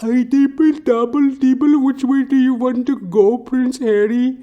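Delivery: very high, slurred muttering voice; high mumbling narcoleptic coworker; mumbling Sleepy. Retold or imitated. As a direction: babble on in a squeaky high mumble. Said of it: "Sleepy's all like".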